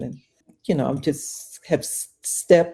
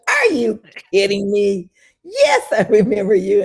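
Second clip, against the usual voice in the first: louder, higher, laughing disbelief; incredulous delight; 5 semitones higher, 6 dB louder than usual. Retold or imitated. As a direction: exclaim in joyful disbelief. Acts out her delighted disbelief.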